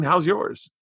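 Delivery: ordinary delivery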